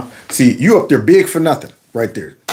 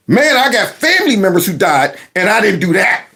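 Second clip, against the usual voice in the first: ordinary talk, nothing marked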